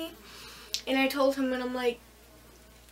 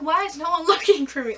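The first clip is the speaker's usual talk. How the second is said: crying out to God; 4 semitones higher, 6 dB louder than usual. Retold or imitated. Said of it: "I start crying out to God".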